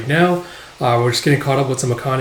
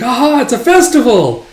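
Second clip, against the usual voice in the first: high, loud festive cry; acted burst of delight; excited exclamation; 10 semitones higher, 6 dB louder than usual. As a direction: announce it with lively excitement. Acted festival scene from the manga.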